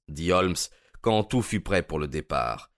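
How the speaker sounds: ordinary delivery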